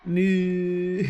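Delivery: "Moo" dragged out as a zombie; long loud zombie moo; Minecraft zombie moo. Shown as a demonstration. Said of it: "in a really deep voice for two to three seconds".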